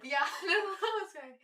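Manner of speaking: laughing high and loud through the words. Real, not acted. Friend reassuring her co-host, laughing loudly. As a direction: laugh it off, amused. Friend jokes about subtle hint.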